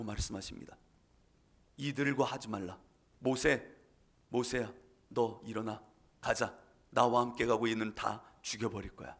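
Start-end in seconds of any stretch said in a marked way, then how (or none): 1.8-9.2 hushed, low divine voice; God's voice. Acted. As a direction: speak as God, softly and gravely. Voicing God quietly.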